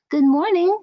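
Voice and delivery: high, loud cheery greeting; friendly creature. A narrator's reading. Little octopus greeting the other fish, high and cheery. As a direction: chirp a bright hello. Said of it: "said the friendly new creature".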